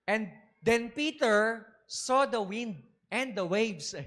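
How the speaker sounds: slow it down so each word lands; slow reading. Shown as a demonstration. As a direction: read out slowly, word by word. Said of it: "sabi doon". (mabagal na pagbasa)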